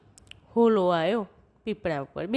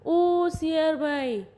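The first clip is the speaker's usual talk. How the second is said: high, drawn-out hail; calling out; 6 semitones higher, slower than usual. Acted. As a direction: hail someone across a field, stretching it. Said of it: "અને બૂમ પાડી".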